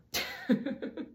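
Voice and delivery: high, breathless laugh. Real, not acted. Storyteller amused by the trauma joke, laughing. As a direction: laugh at your own joke.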